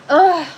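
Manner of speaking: loud frustrated groan. Real, not acted. Gardener to live viewers over her broken plant, loud groan. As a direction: let out a big groan of frustration.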